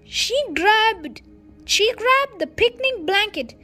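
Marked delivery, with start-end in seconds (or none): none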